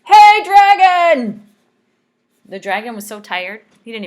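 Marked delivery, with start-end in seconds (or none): 0.1-1.5 much higher and louder, slow yell; loud shout. Acted. Princess yells into the sleeping dragon's ear, loud shout. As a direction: yell right into his ear. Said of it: "shouted as loud as she could".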